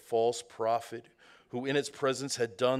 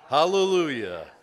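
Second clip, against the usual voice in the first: loud, higher cry of praise; 4 semitones higher, 10 dB louder than usual. A narrator's reading. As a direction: call it out like a great multitude. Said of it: "a great multitude in heaven crying out".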